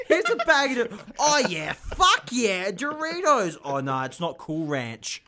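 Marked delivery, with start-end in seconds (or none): 0.1-3.6 high, loud, laughing burst of delight; delighted crackhead. Acted. Crackhead handed a bag of Doritos, loud high delight. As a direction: play a crackhead thrilled by a free snack.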